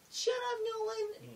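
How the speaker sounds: squeaky high chipmunk squeal, drawn out slowly; high-pitched mock shout at Nolan, like a chipmunk. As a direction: imitate a friend's failed Russian in a tiny cartoon voice. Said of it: "'cause of the chipmunk voice".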